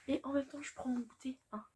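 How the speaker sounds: breathy whispered confession mid-snack; whispered aside. Real, not acted. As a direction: whisper it like a guilty little secret. Aside while eating snack.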